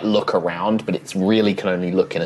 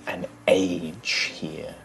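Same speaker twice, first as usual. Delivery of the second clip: story narration; 5 dB quieter than usual. A narrator's reading.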